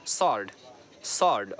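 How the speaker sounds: sword as "sawrd", low and falling; silent w. Shown as a demonstration. Teacher repeating the right word, silent w. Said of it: "not sword in his hand".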